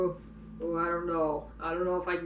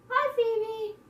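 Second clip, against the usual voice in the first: high pet voice. Real, not acted. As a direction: coo up high at Phoebe. Greeting a pet.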